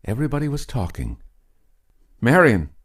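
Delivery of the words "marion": loud, falling call across the cellar. A narrator's reading. Mother calling her silent daughter across the cellar, loud. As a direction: call her name across the dark room. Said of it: "called Louise".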